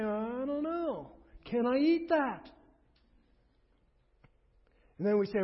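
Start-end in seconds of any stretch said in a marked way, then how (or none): 0.0-2.5 slow, higher, louder hesitant voice; drawn out like a puzzled guest eyeing food; doubtful churchgoer. Retold or imitated. Voicing a doubtful believer.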